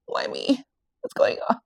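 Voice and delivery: thin whispery pleading; hammed-up weepy lament, voice going to a whisper; mock tearful breakdown. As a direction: plead to God as if breaking down in tears. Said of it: "she's breaking down in tears".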